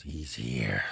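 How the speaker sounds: low, hushed tense report; low urgent hunting hush; hushed warning. Retold or imitated. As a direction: breathe it out under your breath so the bear won't hear. Buddy whispers bear has arrived.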